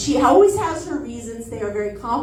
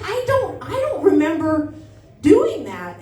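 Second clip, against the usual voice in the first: put-on high, unsure childlike voice; small girl doubting herself; confused little kid; 4 semitones higher than usual. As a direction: play the bewildered nine-year-old. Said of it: "I was like".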